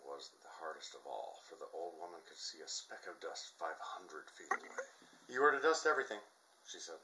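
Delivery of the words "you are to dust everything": high, loud barked order; stern command. A narrator's reading. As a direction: lay down the law. Old woman gives stern order.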